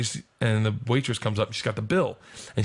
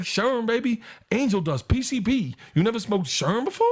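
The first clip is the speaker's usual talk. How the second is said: mock Tracy tease; 11 semitones higher than usual. Tracy teasing about PCP.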